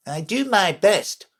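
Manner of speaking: high, sulky messenger. A narrator's reading. Messenger defending himself to the king, sulky. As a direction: protest in a high, wounded voice. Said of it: "said in a sulky tone".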